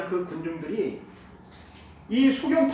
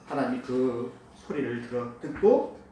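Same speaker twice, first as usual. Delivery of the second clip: ordinary talk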